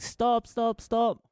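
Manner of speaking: high-pitched imitation of screaming kids; mock screaming. Watching kids plead with the three-year-old, mock screaming. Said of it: "they're screaming".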